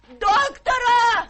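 high, loud, slow cry for help; alarmed drawn-out cry; panicked call. Acted. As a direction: shout for the doctor in alarm. Calling for a doctor in panic.

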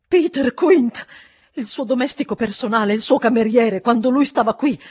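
loud, urgent burst of recognition; agitated exclamation. A narrator's reading. Housekeeper blurts out who the valet was, agitated. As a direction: pour it out at full volume.

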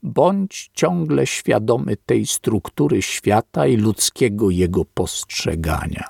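usual speaking voice

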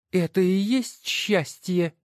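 high, slow, distrustful woman's voice; doubtful. Acted. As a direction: doubt the orange box. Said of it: "недоверчиво спросила она". (недоверчиво, высоким голосом)

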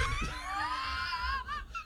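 high shrieking burst of laughter. Real, not acted. Co-host cracking up at the host's blank look, shrieking laugh. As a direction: lose it completely.

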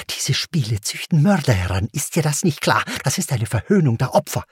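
angry mother's tirade, gaining speed; snorted in anger. A narrator's reading. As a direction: lay into the child indignantly. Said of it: "schnaubte sie".

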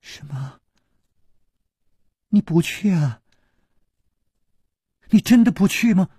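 hushed disbelief rising to pleading; trembling voice. A narrator's reading. Princess asking if he won't go, trembling dismay. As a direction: start barely audible, build to a high question. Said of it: "颤声说".

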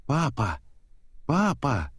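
high-pitched but restrained yell; a child's mild cry for his father; yelling for Dad. A narrator's reading. Nemo spots his father, mildly yelling out to him. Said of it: "завопил Немо".

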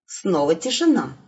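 plain reading voice

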